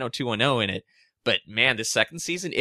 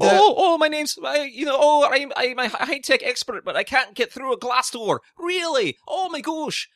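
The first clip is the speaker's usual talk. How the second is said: much higher mock voice of a useless tech expert; sending up the show's tech expert, pitched way up; mocking Fitz; 14 semitones higher, 4 dB louder than usual. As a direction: pitch way up to ridicule the character. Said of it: "I bet that guy's not even Scottish".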